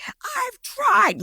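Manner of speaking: very high, screechy imitation of her; mock scream. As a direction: mimic a girlfriend's shrieking protest without full volume. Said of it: "screaming at the top of her lungs".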